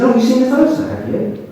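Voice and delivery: bright, eager, a little teasing; excited surprise. Retold or imitated. Janet realising the doctor has seen the photo, excited.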